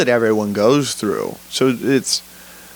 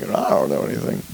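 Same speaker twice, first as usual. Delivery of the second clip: whispered fade-out; 10 semitones lower, 6 dB quieter than usual. Shown as a demonstration. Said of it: "But just go".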